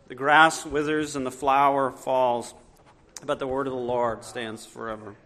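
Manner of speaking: low, measured Bible reading voice; scripture reading. Acted. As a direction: read the verses solemnly to the congregation. Reads scripture before the sermon.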